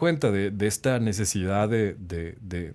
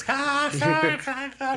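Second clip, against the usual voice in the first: much higher, mocking ha-ha from the whole team; mock laughter; much slower than usual. Coworkers mocking the rookie's slip, high mocking laugh. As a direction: laugh at him the way the crew did.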